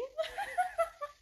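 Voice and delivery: high loud burst of laughter. Real, not acted. Laughing at comments calling her a slave, loud burst. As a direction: crack up at the comments.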